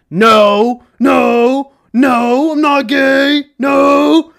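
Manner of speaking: loud, high mock shouting; hammed-up protest. Host answering teasing about his Juul, loud hammed-up denial.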